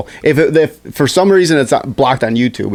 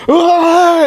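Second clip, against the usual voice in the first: mock high shriek; 3 dB louder than usual. Karen crashes into truck.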